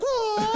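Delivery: high-pitched startled "whoa"; high cried whoa. Acted. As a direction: let out a high whoa, acting the character. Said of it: "and he's like".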